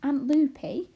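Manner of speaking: sudden excited realisation; exclaiming. A narrator's reading. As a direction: exclaim as the name dawns. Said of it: "she exclaimed".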